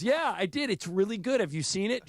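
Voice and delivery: lightly voiced producer fishing for praise; a touch of a keen show-off; eager producer. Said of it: "And he goes".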